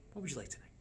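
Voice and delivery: very soft table-side ask. Shown as a demonstration. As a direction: ask the guest hushed and easy. Sample server question to guest.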